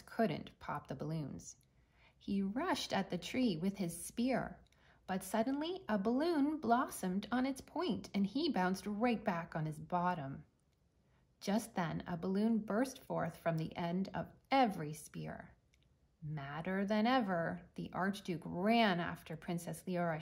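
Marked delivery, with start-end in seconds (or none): none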